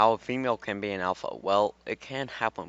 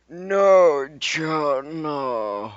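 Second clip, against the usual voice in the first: loud high playful no John no; mock protest; 7 semitones higher, 8 dB louder, much slower than usual.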